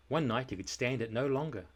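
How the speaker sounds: plain reading voice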